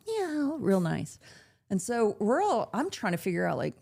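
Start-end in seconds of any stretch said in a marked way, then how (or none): 0.1-0.6 high sweet meow; a cat's sweet meow; imitated cat meow. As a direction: purr it like a pretty cat. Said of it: "sitting pretty".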